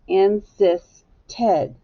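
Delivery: "insisted" said very slowly, the ending split off as ted; slow teacher's breakdown of "insisted" with a separate final ted; broken into parts. Shown as a demonstration. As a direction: pull "insisted" apart as in, sis, ted. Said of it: "when you add the E".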